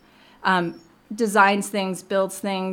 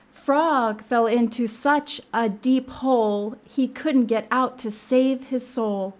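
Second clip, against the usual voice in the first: slow, higher storybook narration; picture-book read-aloud; 5 semitones higher, slower than usual. A narrator's reading. Librarian reading the frog's predicament aloud, slow sing-song storytelling. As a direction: stretch out the rhyme for young listeners.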